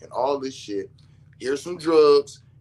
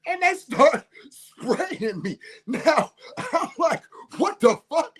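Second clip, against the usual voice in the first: high, slow laughing telling; can barely talk for laughing; squeaky laughing disbelief; laughing through; 13 semitones higher, slower than usual. Real, not acted. Laughing while recalling spray.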